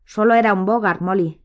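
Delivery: ordinary delivery, nothing marked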